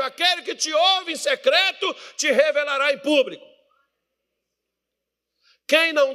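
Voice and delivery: high, pressing sermon voice; impassioned pulpit delivery; full-force preaching. Real, not acted.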